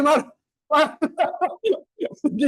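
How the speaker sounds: normal talking voice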